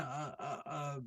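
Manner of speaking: ordinary delivery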